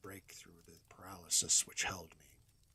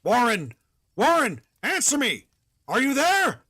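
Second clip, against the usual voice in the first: much higher, much louder frantic calling; desperate shout; 19 dB louder than usual. Acted. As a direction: scream a friend's name into the phone. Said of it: "shouted and screamed".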